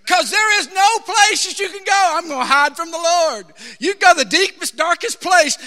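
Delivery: high, loud, fervent preaching; full-force preaching. Acted. Preacher warning those running from God, loud and high. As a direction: cry it out to the whole room.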